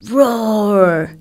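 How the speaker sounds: drawn-out spoken "roar", falling; storybook roar at talking volume; mock lion roar. A narrator's reading. Trapped lion calls out, mild mock roar. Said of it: "Again the lion roared".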